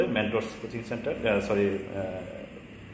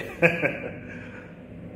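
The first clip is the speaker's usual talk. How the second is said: breathy high laugh; 6 semitones higher than usual. Real, not acted. Laughs at own joke.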